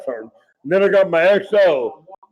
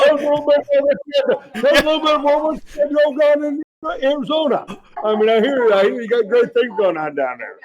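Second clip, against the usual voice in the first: much higher, fake-chummy officer voice; imitating Hardison; 8 semitones higher than usual. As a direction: pump him up like a smarmy officer asking about Arizona. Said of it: "That's how he talks to you".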